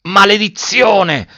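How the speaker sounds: high, drawn-out cursing cry; cry of dismay. A narrator's reading. Officer running to the fallen boy, crying out. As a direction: cry the curse out, stretched long. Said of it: "gridò l'ufficiale accorrendo".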